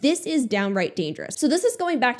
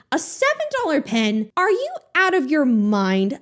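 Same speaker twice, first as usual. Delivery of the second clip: usual speaking voice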